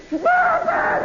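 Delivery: very high-pitched mock holler. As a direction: holler it like a panicked rider, voice way up. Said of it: "Gasser would holler".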